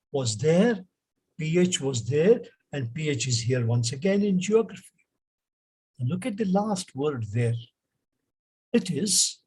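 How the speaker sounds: ordinary delivery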